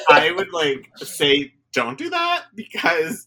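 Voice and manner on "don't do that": mock warning. Friend warning about a tweet.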